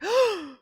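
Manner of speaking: high, sharp gasp of joy; an old father gasping at his son; delighted gasp. Retold or imitated. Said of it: "he's like".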